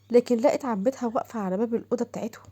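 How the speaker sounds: plain reading voice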